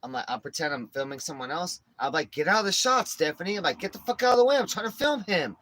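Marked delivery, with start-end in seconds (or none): 2.3-3.6 raised, higher voice ordering someone aside; acted annoyed shout; retold yelling. Retold shouting at woman in shot. 3.8-5.6 loud, high, acted irritation; mock exasperated shout; retold angry yelling. Retold shouting at woman in shot.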